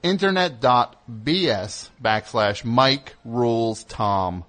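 slow, every word weighted; slow emphatic read. Shown as a demonstration. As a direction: lean on each word of the URL. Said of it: "uh, emphasis".

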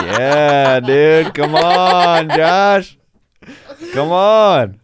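loud, laughing come-on; loud laughing tease. Real, not acted. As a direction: egg him on through laughter.

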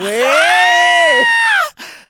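high shrieking cackle; loud shrieking laugh. Real, not acted. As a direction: burst into a shrill laugh. Laughs after own joke.

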